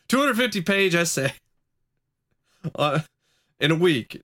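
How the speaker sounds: high disbelieving exclamation; can you imagine; incredulous. Real, not acted. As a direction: pitch it up at the absurd idea. Laughing at a huge workload.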